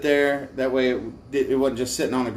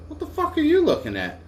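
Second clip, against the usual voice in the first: mock confrontational. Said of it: "I said".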